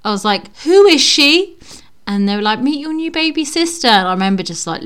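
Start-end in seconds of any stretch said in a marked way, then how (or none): 0.6-1.6 loud, high-pitched mock outrage; indignant child's demand. Retells child meeting new baby. 2.6-3.9 high, sweet announcement voice; mock proud parents. Quotes parents presenting the baby.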